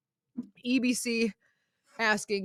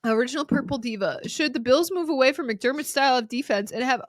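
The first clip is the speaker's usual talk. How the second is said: ordinary talk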